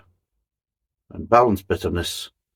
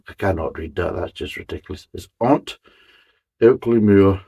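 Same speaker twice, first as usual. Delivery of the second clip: ordinary talk, nothing marked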